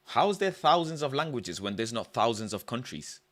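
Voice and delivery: normal talking voice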